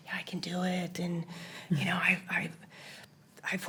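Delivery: hushed self-encouragement; whispering. Retold or imitated. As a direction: whisper your pep talk. Whispered self pep talk.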